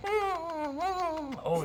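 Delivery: monkey voice. Acted.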